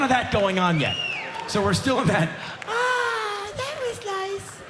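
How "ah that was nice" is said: put-on high, airy, satisfied sigh of a girl; dreamy girl voice. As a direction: float it up high, contented.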